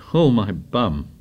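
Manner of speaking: rueful. A narrator's reading. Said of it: "rubbed his rump ruefully".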